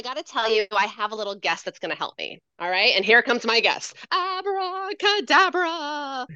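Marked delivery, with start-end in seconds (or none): 4.1-6.3 drawn-out magic show reveal; puppet announcement. Acted. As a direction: introduce the puppet with wonder. Teacher introduces puppet guest.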